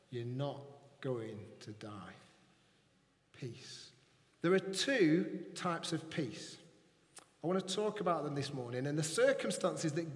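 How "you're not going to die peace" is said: fading to a whisper. Acted. Comforting words fading to whisper.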